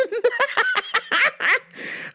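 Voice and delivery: a burst of high shrieking laughter; high-pitched laugh. Real, not acted. Cracking up at a meme of pelvic thrusts, high laugh. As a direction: crack up hard at the memory.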